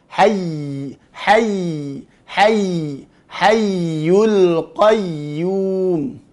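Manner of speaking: HAYY with the doubled Y drawn out unbroken; flowing ya. Shown as a demonstration. Teacher drilling the fix, sustained flowing YY. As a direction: let HAYY run on into YUL QAYYUM.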